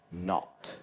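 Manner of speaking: "not" with a rounded British O; slow British "not", lips in a circle; British accent. Shown as a demonstration. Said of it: "the sound O".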